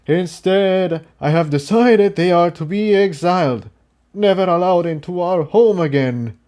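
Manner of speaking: stern leader's verdict. Acted. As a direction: declare the exile firmly. Starburst continues the verdict.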